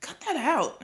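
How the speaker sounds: high, loud objection; annoyed protest. A narrator's reading. Boy objects to being teased.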